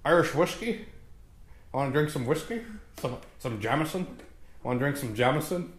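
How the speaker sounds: softly offered mock Irish accent; lightly put-on, questioning Irish lilt; attempted Irish accent. Host trying Irish after slipping into Australian, hesitant Irish accent. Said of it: "he's fucking Irish".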